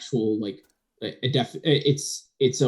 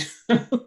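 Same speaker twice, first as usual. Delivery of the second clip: a quick high laugh out loud; burst of laughter; 12 semitones higher, 3 dB louder than usual. Real, not acted. Laughing at the gun story. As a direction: laugh right out at it.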